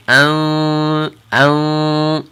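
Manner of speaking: slow nasal "an" held before ta; ikhfa sound. Shown as a demonstration. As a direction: hold "an" in the nose, slowly. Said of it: "a little different sound".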